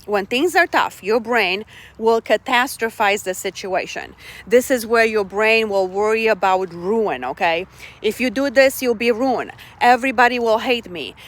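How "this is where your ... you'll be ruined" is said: normal talking voice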